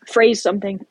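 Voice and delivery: coughing through a spicy bite; coughing while eating. Real, not acted. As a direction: talk between coughs, eating.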